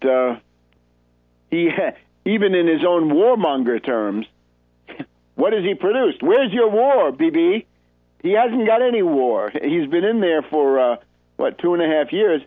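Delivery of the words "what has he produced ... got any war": mocking taunt. Real, not acted.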